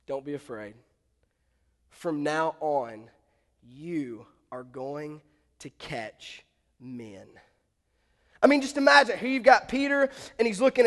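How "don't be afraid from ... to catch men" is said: low, soft, slow and solemn; calm a frightened follower, unhurried; quiet commissioning with long pauses; gentle reassurance. Acted. Jesus reassures a fearful Peter.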